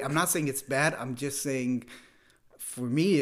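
ordinary talk

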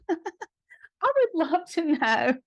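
slow, laughing through the words; amused, drawn out with a laugh. Real, not acted. Laughs at the age question.

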